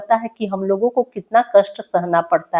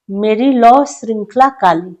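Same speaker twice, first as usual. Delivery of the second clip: louder declaimed verse line; poem recitation; 6 dB louder than usual. Acted. Teacher reciting the line about iron chains, louder.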